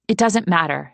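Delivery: ordinary delivery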